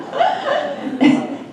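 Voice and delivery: airy giggle up high; high breathy laugh. Real, not acted. Laughing about the eager client teaching everyone. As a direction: let out a breathless squeak of a laugh.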